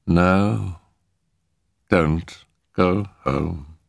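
slow, stretched ghostly plea; deliberate voice from beyond; ominous spirit warning. A narrator's reading. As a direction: draw out each word with pauses.

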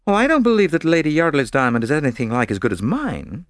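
a hint of a spoiled star; boastful actress. A narrator's reading. Actress boasting about her diamond.

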